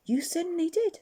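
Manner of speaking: Jack's voice. Acted. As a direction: pitch up to voice young Jack. Jack agrees happily.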